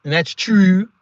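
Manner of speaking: teasing Baltimore-accent mimicry; mock Baltimore accent. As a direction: exaggerate the Baltimore fronted oo in 'true'. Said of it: "That Baltimore accent from a female".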